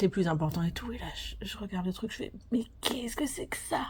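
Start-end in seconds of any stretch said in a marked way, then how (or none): 2.5-3.8 hushed, higher-pitched mock bafflement; retold stunned whisper; baffled disbelief. Retold bafflement at the exam question.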